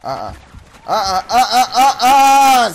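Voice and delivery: building uh-uh. Real, not acted. Repeated building taunt.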